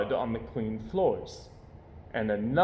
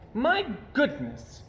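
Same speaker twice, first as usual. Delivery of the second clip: complaining; 12 semitones higher, slower than usual. Acted. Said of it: "she complained".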